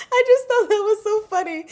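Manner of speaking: giggling while explaining the joke; laughing. Real, not acted. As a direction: speak through helpless laughter. Still laughing at the scene.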